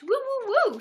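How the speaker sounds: high, loud excited woo-hoo cheer. Real, not acted. Cheering the viewers on for likes, high loud whoops.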